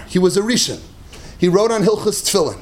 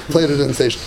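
usual speaking voice

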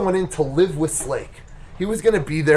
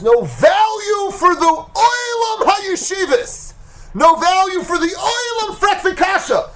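high, drawn-out cry of indignation; real pulpit outrage, pitched way up; impassioned preaching shout. Real, not acted. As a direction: cry out in protest, stretching the words. Preacher decries the world's values.

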